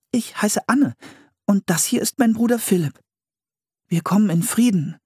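put-on high, friendly girl voice; Anne's girl voice. Anne introducing herself and Philipp to the settlers. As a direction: greet strangers openly.

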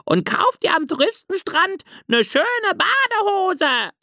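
hohe, gehauchte Möwenstimme; rufende Möwe. A narrator's reading. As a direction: ruf wie eine kleine Möwe vom Strand. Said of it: "rief laut die Möwe Rose". (hohe Möwenstimme, rufend)

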